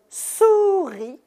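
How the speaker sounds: "souris" slowly, with a long-held "sou"; syllabes étirées. Shown as a demonstration. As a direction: draw out "souris" to show "sou" comes first. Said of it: "Quand je dis le mot". (« souris » étiré, syllabe par syllabe)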